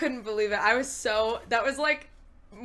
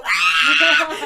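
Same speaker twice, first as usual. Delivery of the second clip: scream; 3 semitones higher, 8 dB louder than usual. Retold or imitated. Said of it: "you can hear me go".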